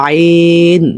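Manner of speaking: teacher modelling the letter আইন; model pronunciation. Shown as a demonstration. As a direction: sound out আইন as a model for students. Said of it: "উচ্চারণগুলো আমি যেরকম করতেছি".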